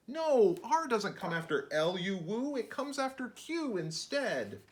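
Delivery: exasperated, teasing correction; mildly annoyed scolding; correcting narrator. Acted. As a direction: set the silly woo straight.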